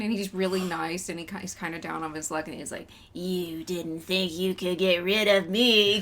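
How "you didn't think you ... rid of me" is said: villain voice. Retold or imitated. Menacing villain quote retold.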